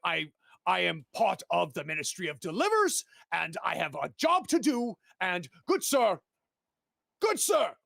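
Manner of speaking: high, stammering plea for help; a flustered courier begging a stranger; pleading gentleman. Acted. Courier begging the passerby for help, high and stammering. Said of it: "and he goes".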